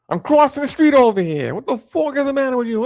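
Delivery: high, loud New York street yell; angry New Yorker. Acted. Typical New Yorker crossing the street, angry New York yell. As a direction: holler in an outraged, hammed-up New York accent. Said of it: "your typical New Yorker sounds like what?".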